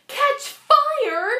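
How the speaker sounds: very high horrified squeak; a frightened child's gasped question. A narrator's reading. Jessica horrified by the teacher's warning, high squeak.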